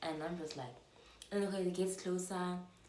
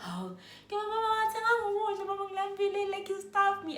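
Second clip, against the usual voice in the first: put-on babyish wailing; 13 semitones higher, 6 dB louder than usual. Starved speaker begging her mom for food, babyish wailing. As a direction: wail to mom like a starving baby. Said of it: "And I'm such a baby".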